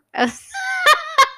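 sudden high-pitched burst of laughter. Real, not acted. Laughing at her own misreading of assassination, a high burst. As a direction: break into a squealing laugh.

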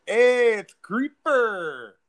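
loud, high, drawn-out Latin street greeting; Latin accent. Pretend. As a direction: call it out slowly in a mock Latin accent. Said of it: "like, Latin".

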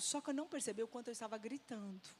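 ordinary talk

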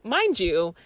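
ordinary delivery

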